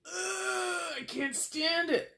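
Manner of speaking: high-pitched, drawn-out exasperated groan and complaint; irritable outburst. Acted. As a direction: act driven up the wall. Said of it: "you're constantly irritable".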